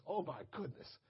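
very soft, low, awestruck; barely voiced reverent amazement; hushed awe. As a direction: drop to an overwhelmed murmur. Awed reaction to God's voice.

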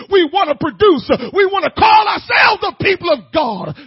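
loud, high, shouting sermon; preaching at full force. Acted.

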